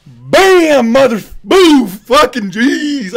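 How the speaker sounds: loud exclamations, still overwhelmed; excited outburst. Real, not acted. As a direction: blurt it out, unable to hold back. Reliving the knockout.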